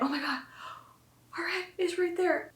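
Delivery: put-on mother feeling the baby's head, stunned; amazed disbelief. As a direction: say it like you can't believe it. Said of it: "I just remember being like".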